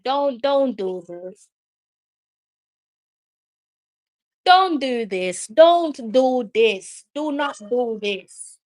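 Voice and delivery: slow, drawn-out pleading; slow begging don't do this. Real, not acted. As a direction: beg slowly, stressing don't. Begging team not to concede.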